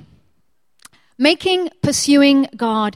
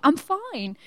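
tearful reply; 4 semitones higher, 7 dB quieter than usual. Retold or imitated. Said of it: "I'm sobbing".

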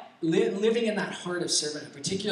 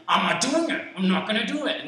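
high, louder mock outburst; fed-up refusal; 7 semitones higher, 3 dB louder than usual. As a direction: act out roommates at breaking point, pitched up. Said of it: "breaking points where we're all like".

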